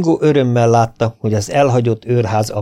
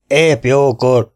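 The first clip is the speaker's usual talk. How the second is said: shouted out; 4 semitones higher, 4 dB louder, much slower than usual. A narrator's reading. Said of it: "kiáltotta Lindsay".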